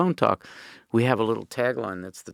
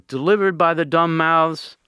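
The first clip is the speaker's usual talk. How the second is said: higher, louder, declaiming like a beat poet; 6 semitones higher, 4 dB louder than usual. Retold or imitated. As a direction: intone it like a fifties beat poet. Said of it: "Beat poet voice".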